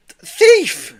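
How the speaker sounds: very high, loud cry of thief; yell. A narrator's reading. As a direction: shout it out to raise alarm. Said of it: "he yelled".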